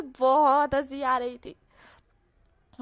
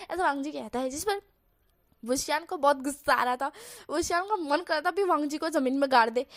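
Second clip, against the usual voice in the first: plain reading voice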